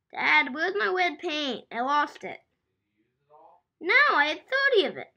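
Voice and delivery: loud call to dad; yelling across the room. Real, not acted. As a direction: shout across the house to dad. Child calls dad across room.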